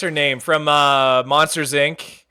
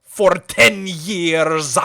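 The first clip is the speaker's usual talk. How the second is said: pirate voice; 3 semitones lower, much slower than usual. Acted. Davy Jones impression bit.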